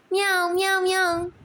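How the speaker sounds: high, loud playful meowing; mock kitten mewing; cat meow. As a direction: meow sweetly to lure the cat. Wife imitates cat to lure it.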